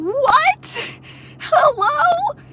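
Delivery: very high, loud "What? Hello?" in disbelief; shrieking mock outrage; incredulous squeal. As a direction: squeal it like you can't believe your ears.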